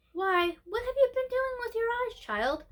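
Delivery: a surprised grandmother noticing tears; old lady asking the child why her eyes are red. Acted. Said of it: "asked the old lady".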